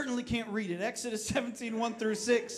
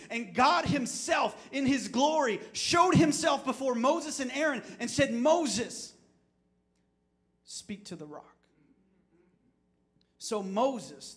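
loud, higher, fervent preacher telling the story; preaching at full force; 6 semitones higher, 4 dB louder than usual. Acted. Pastor retelling Moses to his congregation, fervent preaching. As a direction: build it like a pulpit climax.

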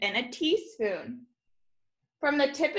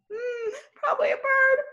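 high, fast squealing cry; creeped-out squeal; faster than usual. Real, not acted. Mock-scared answer about spider.

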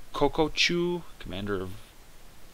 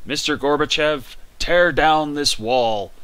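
presidential speech; 4 semitones higher, 6 dB louder, slower than usual. Retold or imitated. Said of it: "remember that speech".

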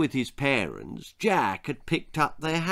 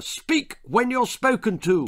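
father's raised, high roar; roared order; 10 semitones higher than usual. A narrator's reading. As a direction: roar it as a rebuke. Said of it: "roared his father".

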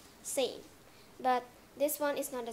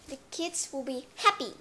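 ordinary delivery

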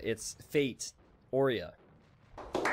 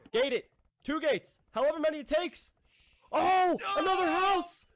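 high, rising game cheering; excited calls; 4 dB louder than usual. Real, not acted. Caster cheering the walling off of a base, high and thrilled. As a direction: yelp the plays out with glee.

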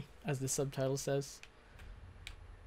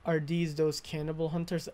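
ordinary delivery

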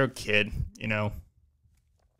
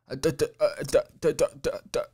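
stuck on duh over and over; mock a boy tripping on each duh; stammered duh duh in a retold voice; imitating a stutter; 3 semitones higher than usual. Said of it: "he just started stuttering".

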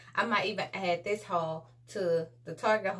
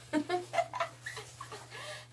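soft giggle; 9 semitones higher, 6 dB quieter than usual. Real, not acted. Laughs after own singing.

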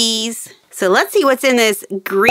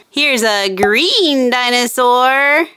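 usual speaking voice

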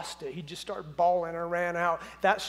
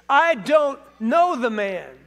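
louder, slow, swearing it; sworn denial; 3 semitones higher, 7 dB louder, slower than usual. A narrator's reading. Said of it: "he swore to them".

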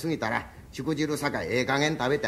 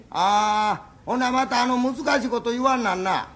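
reluctant groan; 6 semitones higher, 4 dB louder, slower than usual. Acted. Husband groans at the condolence chore.

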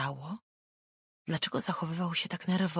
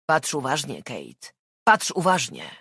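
menacing; 8 dB louder than usual. Acted. Man threatening the girl.

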